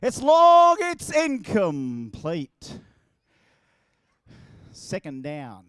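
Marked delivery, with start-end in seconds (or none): 0.0-2.8 a high, loud call that drops into a slow, deflated "incomplete"; mock commentator. Pretend commentator calling his own missed pass, with hammed-up letdown. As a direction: call the pass and let it fall flat.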